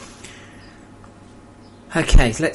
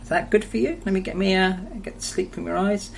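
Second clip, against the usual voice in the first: higher, gentle pet voice; soft pet talk; 7 semitones higher, 4 dB quieter than usual. Real, not acted. Owner cleaning his cat's eyes, coaxing in a higher pet voice. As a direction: coax the cat while wiping its eyes.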